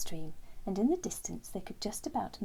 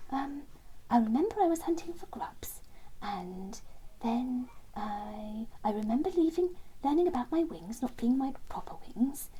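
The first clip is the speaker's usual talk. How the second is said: higher, hesitant character voice; haltingly piecing it together, pitched up; Terence remembering; 4 semitones higher than usual. Acted. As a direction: feel your way through a hazy memory.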